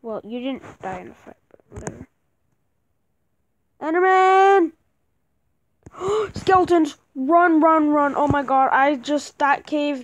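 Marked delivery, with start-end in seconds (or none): 3.8-10.0 yelling while running away. Real, not acted. Monsters appear, fleeing the cave.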